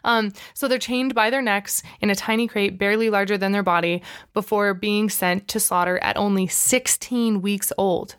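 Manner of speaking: usual speaking voice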